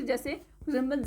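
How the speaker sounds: usual speaking voice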